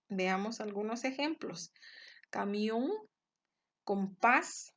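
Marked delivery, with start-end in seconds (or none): 2.3-2.9 Camión with weight on the final MIÓN; stress on last syllable. Shown as a demonstration. Teacher giving examples of agudas, Camión with stressed MIÓN. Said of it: "en la última sílaba". 3.8-4.7 compás with a louder final PÁS; stress on last syllable. Shown as a demonstration. Teacher giving examples of agudas, compás with stressed PÁS. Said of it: "en la última sílaba".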